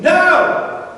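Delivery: loud, high shouted reply; shouted chorus answer. Retold or imitated. Fishermen answering the man on the beach, shouted. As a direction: shout back as a whole crew. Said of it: "as in a chorus".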